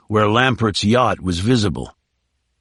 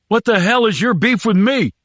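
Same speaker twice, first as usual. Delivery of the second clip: high, frightened shout; 10 semitones higher than usual. A narrator's reading. Prisoner shouting at his captor on the sand, high panicked shout. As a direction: yell it up in the air in panic. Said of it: "he finally shouted".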